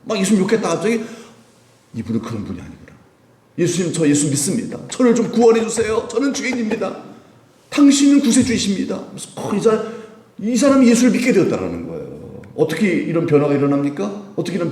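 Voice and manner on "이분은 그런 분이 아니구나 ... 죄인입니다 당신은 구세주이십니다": fervent plea. Acted. Thief's conversion plea.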